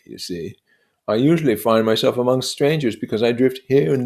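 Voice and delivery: lightly voiced proud storyteller, rising; boasting of medals, building up; Gadsby telling his war tale. Gadsby boasting to Nick of his war medals, proud.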